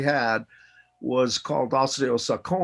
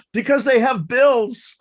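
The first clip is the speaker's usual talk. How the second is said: put-on kids' answer; 10 semitones higher, 6 dB louder, slower than usual.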